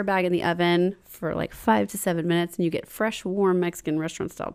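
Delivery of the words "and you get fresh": ordinary talk, nothing marked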